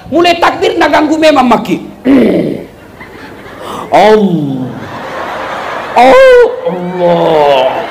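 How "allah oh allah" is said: low, drawn-out mock-pious Allah; very slow, low mock-devout sigh; showy pious groan. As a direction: ham up an over-pious worshipper. Mocking showy pious worshipper.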